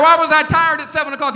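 ordinary talk, nothing marked

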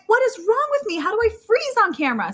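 high-pitched mock despair; retold self-reproach. Contestant to herself after blowing the audition, retold despair.

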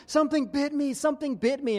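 put-on yelping it out like a scared boy; son in pain. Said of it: "and he's like".

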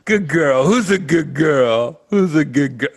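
put-on loud, high baby talk to a dog; doggy baby talk. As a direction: coo loudly at a good girl. Acting out roast joke with dog.

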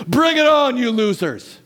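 loud, high taunt, hammed up; mock loud cry. Preacher misquoting dying Stephen as a joke, loud mock taunt. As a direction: throw it out as a cocky challenge. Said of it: "cried out in a loud voice".

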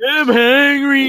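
high, loud, slow mock whine; mock the big baby; whiny baby voice. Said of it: "You sound like a big baby".

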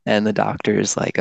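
ordinary talk

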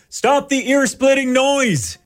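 angry farmer. Retold or imitated. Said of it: "a farmer runs out and says".

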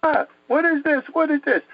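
high-pitched mock scream of alarm; imitated frightened yelp, not full volume; retold screaming. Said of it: "I started jumping up and screaming".

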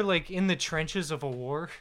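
ordinary talk, nothing marked

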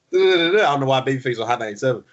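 ordinary delivery

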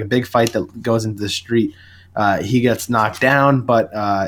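ordinary talk

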